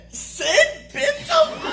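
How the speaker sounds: very high, loud, slow disbelief; acted astonishment, squealed and drawn out; incredulous hilarity. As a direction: shriek the question, breaking into laughter. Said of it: "he burst out laughing".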